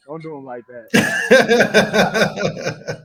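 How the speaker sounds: drawn-out, laughing don't do him like that; laughing tease. Real, not acted. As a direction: stretch it out slowly through laughter. Laughing tease at friend Twan.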